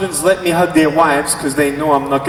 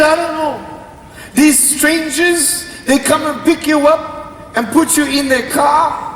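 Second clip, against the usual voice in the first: high, exasperated mom voice; hammed-up cross mother listing her suspicions; angry mother; 10 semitones higher than usual. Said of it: "She's so angry".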